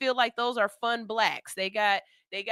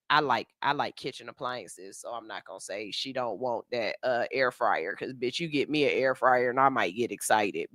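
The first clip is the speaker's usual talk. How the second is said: much lower, softer confiding aside; 10 semitones lower, 6 dB quieter than usual. Real, not acted. Telling viewers she'd like an air fryer, low aside. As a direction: drop into a low private admission.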